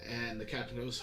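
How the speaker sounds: ordinary talk, nothing marked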